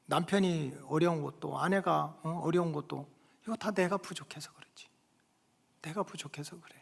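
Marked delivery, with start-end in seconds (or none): 3.4-4.9 hushed self-blame; whispered. Real, not acted. Preacher confessing fault, whispered. 5.8-6.7 soft take, 내가 said low and quiet. Real, not acted. Preacher whispering self-blame, soft.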